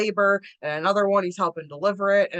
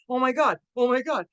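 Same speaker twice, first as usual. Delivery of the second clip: retold freak-out; 6 semitones higher than usual. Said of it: "freaking out going".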